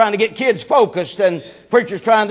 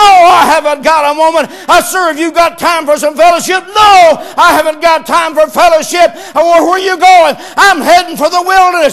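fiery preaching; 4 semitones higher, 5 dB louder than usual. Acted. Preacher proclaiming the shepherd's search to the church, full-force preaching. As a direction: preach it out to the congregation, building and slowing.

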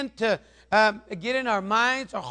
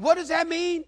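puzzled question; 8 semitones higher than usual. Retold or imitated. Pastor questions the widow.

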